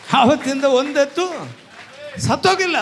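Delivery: high, loud and broken in two; emphatic shouted testimony. Real, not acted. Preacher testifying no ox died since that day, emphatic shouted testimony. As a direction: drive each half home at high pitch. Repeats testimony at full force.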